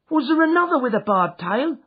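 high, loud anxious asking; anxious question. A narrator's reading. Jem anxiously asking his sister about the goose, high and loud. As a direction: ask it in rising dread. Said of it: "I asked".

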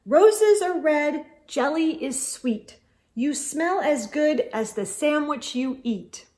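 put-on boy reciting a sweet poem to a friend; poem recital. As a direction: recite it like a valentine rhyme.

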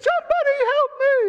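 high, loud desperate cry; tiny trapped man shrieking for rescue; crying out for help. Acted. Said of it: "he's crying out".

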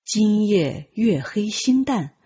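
plain reading voice

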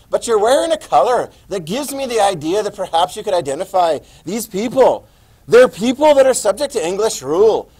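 put-on loud, animated Irish accent; theatrical Irish accent. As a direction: give the clue in a big Irish brogue. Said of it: "see if you can figure out my accent".

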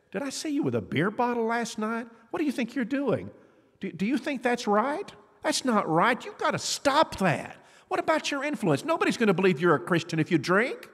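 high-pitched scolding of a teammate; acted-out reproach, voice jumping high; indignant confrontation. Teen confronts teammate over drinking.

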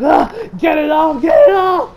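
high, loud frantic cry; mock panicked shout. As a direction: yell it in acted panic. Acts out character panicking.

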